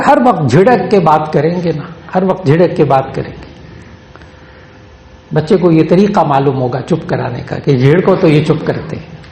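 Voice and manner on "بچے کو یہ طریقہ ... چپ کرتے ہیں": usual speaking voice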